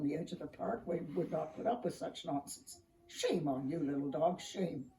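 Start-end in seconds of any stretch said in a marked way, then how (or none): 3.1-4.9 lower, scolding tree voice; stern tree. A narrator's reading. Tall pine tree scolding the dog, lower and stern. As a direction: scold him gravely.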